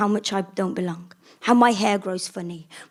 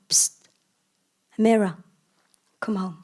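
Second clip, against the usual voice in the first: whisper. Acted. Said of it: "voices ... whisper".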